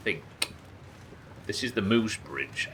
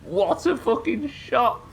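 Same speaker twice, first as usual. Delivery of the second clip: high, slow, drawn-out amazement; gleeful disbelief; 4 dB louder, slower than usual. Real, not acted. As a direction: savour the lucky shot in awe. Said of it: "he says, hitting the biggest thing".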